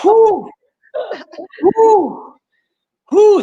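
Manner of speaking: short high "whew" that falls away; repeated whoop. Real, not acted. Host reacting to the banter, repeated whoop. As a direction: let out separate high falling whoops.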